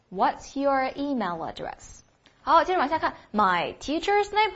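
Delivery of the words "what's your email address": slow, clear repeat of the question. Shown as a demonstration. Teacher repeats the question slowly for students to copy. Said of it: "应该怎么问来着".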